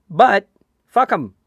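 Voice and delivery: dismissive, emphatic curse mid-rant; defiant dismissal. Real, not acted. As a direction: spit it out slowly with contempt. Ranting about Burnley.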